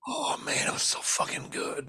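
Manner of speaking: breathy whispered savouring; low-volume, unvoiced swoon over a drink; hushed relish. Real, not acted. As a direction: whisper it like pure bliss. Savouring the memory of a beer.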